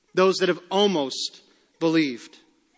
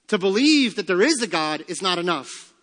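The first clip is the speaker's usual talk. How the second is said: ordinary talk, nothing marked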